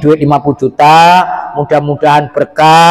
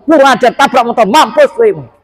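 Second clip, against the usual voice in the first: furious mother cursing, higher; angry curse; 6 semitones higher than usual. Retold or imitated. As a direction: spit out the curse in anger. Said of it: "Terucaplah mamanya". (kutukan ibu yang marah)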